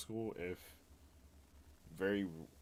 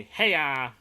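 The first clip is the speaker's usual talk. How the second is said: loud, raised mimicry of the manager; boss's call; 5 semitones higher, 12 dB louder than usual. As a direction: call out like a rushed boss. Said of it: "as soon as we heard him say".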